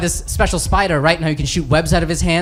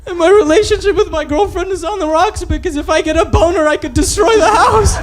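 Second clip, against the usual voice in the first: hammed-up tearful wail, climbing much higher; mock crying; 10 semitones higher than usual. Hulk breaking down before the other heroes, mock crying. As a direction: build from apology into a high comic sob. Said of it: "I'm crying".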